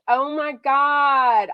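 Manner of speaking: usual speaking voice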